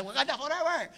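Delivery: mock grito agudo de Wakanda forever; grito del cine. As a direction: corea como el público del cine. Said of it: "empiezan el cine a gritar". (grito de fan imitado)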